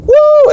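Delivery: very high, loud "woo"; mock cheer; imitated crowd whoop.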